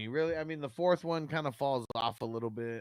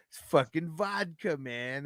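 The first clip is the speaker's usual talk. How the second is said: mock outrage; 6 semitones higher, 6 dB louder than usual. Spitting out drink in court.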